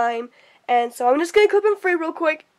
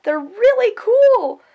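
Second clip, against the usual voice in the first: high, louder thank-you; bright thanks; 10 semitones higher, 4 dB louder than usual. Real, not acted. Thanking Aunt Sabrina for the gift, brightly. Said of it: "thank you".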